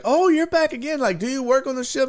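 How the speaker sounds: high-pitched imitated staffer; surprised crew member. Crew recognizing a returning diner, surprised and curious. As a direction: mimic a curious worker in a high voice.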